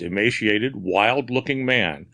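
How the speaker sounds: ordinary delivery, nothing marked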